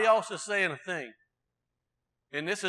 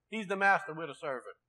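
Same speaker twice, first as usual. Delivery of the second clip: ordinary delivery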